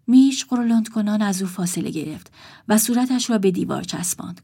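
usual speaking voice

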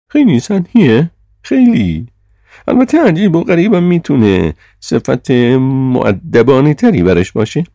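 very high, loud put-down; cutting criticism in the chairman's high voice; Sir John, dismissive chairman. A narrator's reading. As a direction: deliver the verdict high and loud.

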